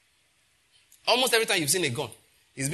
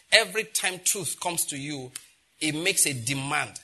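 ordinary talk

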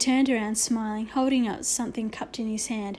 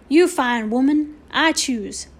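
Indian chief voice; 2 semitones higher, 7 dB louder, slower than usual. A narrator's reading. Little Bear voiced.

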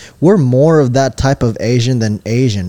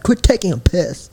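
higher-pitched mock British line; British accent; 6 semitones higher than usual. Shown as a demonstration. Host repeating for friend to copy, mock British. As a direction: demonstrate it in playful British, voice lifted. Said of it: "something a British person would say".

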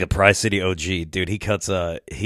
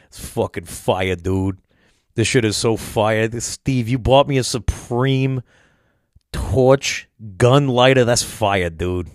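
imitated Boston homie, dropping the R's; drawn-out Boston accent, punching key words; slower than usual. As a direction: do a Boston guy hyping things up. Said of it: "that little motherfucker sounded Boston-y".

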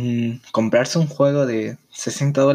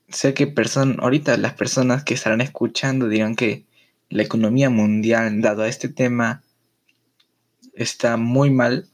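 ordinary talk, nothing marked

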